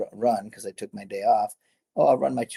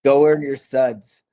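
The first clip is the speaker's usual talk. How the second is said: "go earn your suds" louder and higher, slow and deliberate; emphatic motto; 5 semitones higher, 8 dB louder, slower than usual. Retold or imitated. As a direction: drive the motto home, slowly and loud. Repeating his motto with emphasis.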